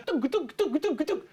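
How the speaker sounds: high, rapid bouncing thunks; imitated bumping. Shrek tumbles down the scales, high bumping noises. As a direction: mimic a body bumping down steps.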